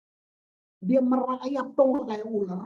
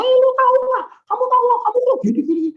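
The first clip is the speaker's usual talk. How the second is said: much higher, louder chatter; excited gossiping; 14 semitones higher, 10 dB louder, faster than usual. Acted. Imitating people telling a friend a story, excited gossip. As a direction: burst out as if gossiping to a pal. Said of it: "Giliran cerita sama temen".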